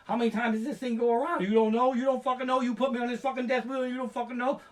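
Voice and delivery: high, fast panicky whine; hammed-up near-crying panic; mock hysteria. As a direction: wail it like you're trapped up there. Panicking rider on wheel.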